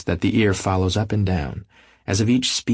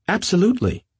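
sudden high exclamation; sudden outburst; 13 semitones higher than usual. Acted. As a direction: blurt it out abruptly, high. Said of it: "with such suddenness that I started".

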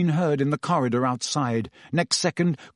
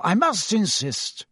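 slow, firm, moderately raised pitch; firm insistence; 7 semitones higher, slower than usual. A narrator's reading. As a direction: overrule her with composed authority. Dumbledore firmly overrules the matron.

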